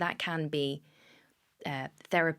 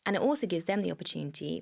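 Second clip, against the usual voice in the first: ordinary talk, nothing marked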